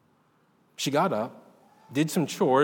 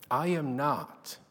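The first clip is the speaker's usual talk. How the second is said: tearful; 7 dB quieter, slower than usual. A narrator's reading. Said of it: "she said tearfully".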